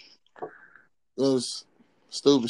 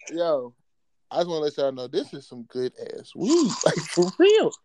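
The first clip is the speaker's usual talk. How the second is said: ordinary talk, nothing marked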